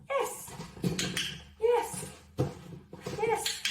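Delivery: squeaky high praise word to a dog. Real, not acted. Trainer praising her dog Misty, high excited praise. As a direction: lift way up, bright praise for a good dog.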